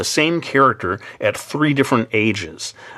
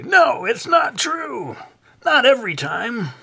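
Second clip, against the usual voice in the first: high, drawn-out whiny protest in a put-on voice; objecting nitpicker; slower than usual. Imitating a manga fan catching the artist's slip, high mock protest. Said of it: "someone will say".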